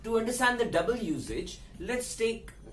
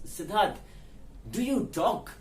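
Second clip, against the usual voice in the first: higher-pitched, frustrated questioning; 4 semitones higher than usual. Acted. Person at meeting asks silent attendee, frustrated. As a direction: ask it as if finally fed up. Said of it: "out of that frustration".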